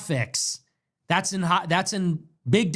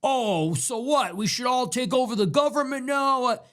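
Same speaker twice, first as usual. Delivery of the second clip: mocking critics; 6 semitones higher than usual. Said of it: "idiots said".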